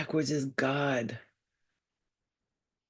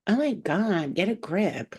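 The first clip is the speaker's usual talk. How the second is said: higher-pitched exasperated teen mimicry; mock teenager; 4 semitones higher than usual. Mother mimicking teens at her excitement, mock exasperation. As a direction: imitate a teenager put off by a parent. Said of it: "they're like".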